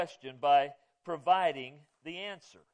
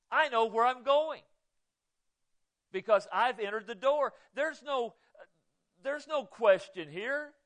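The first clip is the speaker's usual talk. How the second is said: raised, higher and louder, proclaiming; emphatic conviction; 7 semitones higher, 4 dB louder than usual. Real, not acted. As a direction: declare it with total certainty. Preacher's emphatic personal testimony.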